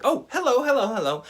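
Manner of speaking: high, hammed-up cheerful greeting; mock happy hellos. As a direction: pretend you're just glad to say hello. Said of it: "the first time's happy".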